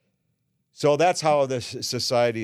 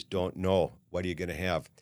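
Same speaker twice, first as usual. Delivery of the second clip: lower, softer, bored monotone; a lightly voiced dull barman brushing you off; boring bartender; 5 semitones lower, 5 dB quieter than usual. Bartender NyQuil brushes off a chatty guest, lightly bored. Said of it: "so goddamn boring".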